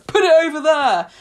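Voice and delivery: a hint of a bossy recycling worker; scary man. Scary recycling worker orders the customer about, mock intimidating. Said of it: "the scary man that works there".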